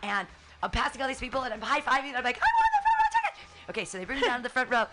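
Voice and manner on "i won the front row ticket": excited shout. Retold or imitated. High-fiving people on the way.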